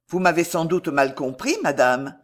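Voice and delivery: ordinary delivery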